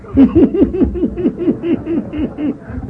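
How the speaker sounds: long, very high-pitched giggle; imitated laugh. Imitating the meeting speaker, long high laugh. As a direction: mimic a helpless high cackle that keeps going. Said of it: "Then he got up and he went".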